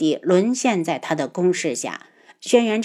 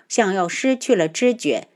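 ordinary delivery

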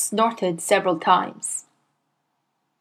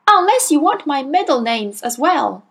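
put-on a higher boy's voice; cheeky small boy; 6 semitones higher, 4 dB louder than usual. A narrator's reading. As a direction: answer back, brave but small. Boy answers boldly.

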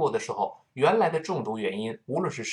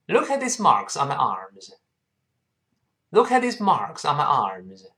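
快读；比慢速示范更连贯紧凑地读整句；一口气连读整句；快一点的领读示范. Shown as a demonstration. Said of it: "好，我们再读快点".

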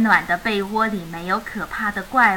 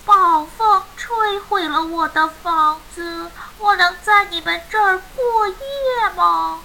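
helpless reindeer; 6 semitones higher than usual. A narrator's reading. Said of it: "无奈地说".

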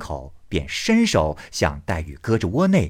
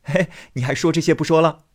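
Baoyu laughing; 7 semitones higher, 4 dB louder, faster than usual. Acted. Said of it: "笑问道".

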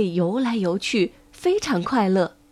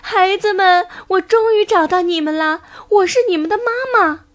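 high, loud, delighted reunion; happy mother frog; 7 semitones higher, 4 dB louder than usual. A narrator's reading. Mother frog finding her children, high and overjoyed. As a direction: cry out joyfully to your children. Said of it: "高兴地说".

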